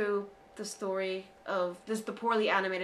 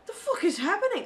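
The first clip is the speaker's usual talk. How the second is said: baffled; 9 semitones higher, 4 dB louder than usual. Retold or imitated. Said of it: "I was just like".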